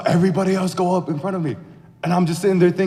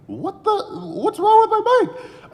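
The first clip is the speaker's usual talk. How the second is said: much higher, startled cry of alarm; alarmed squeal. Acted. Rider hears his bike make a weird sound, alarmed squeal.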